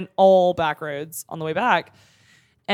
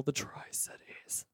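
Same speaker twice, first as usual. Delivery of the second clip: whispering; 4 semitones lower, 19 dB quieter, slower than usual. Real, not acted. Whispered aside about hometown.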